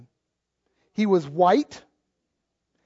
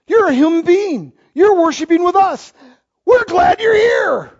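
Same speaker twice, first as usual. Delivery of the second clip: loud welcome; 7 semitones higher, 5 dB louder than usual. Acted. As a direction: belt it out like a fired-up preacher. Preacher welcoming visitor loudly.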